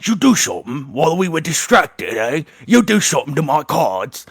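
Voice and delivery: lightly voiced cockney accusation; gruff card player. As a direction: accuse him like a suspicious rough. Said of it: "one of them goes".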